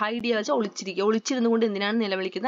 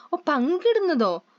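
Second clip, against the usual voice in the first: surprised echo; 6 semitones higher than usual. Acted. Villagers echo share in surprise.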